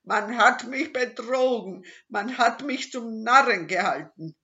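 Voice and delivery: loud, high enraged robber; furious shout. Acted. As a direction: yell at being cheated. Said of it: "schrie der Räuber Hotzenplotz zornig".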